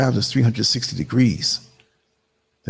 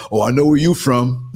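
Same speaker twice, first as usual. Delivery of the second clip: real amused laughter round the words; laughing; 5 semitones higher than usual. Real, not acted. Host joking that the accent tells on them, laughing. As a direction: break into laughs either side of the quote.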